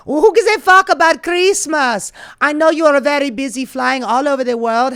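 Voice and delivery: hammed-up bad Slovenian accent, loud and high; Melania. Melania's email to her friend, mock Slovenian accent pushed high and loud. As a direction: belt it out in a fake Slovenian accent. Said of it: "I do a shit Slovenian accent".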